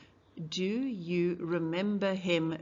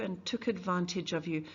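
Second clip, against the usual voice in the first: usual speaking voice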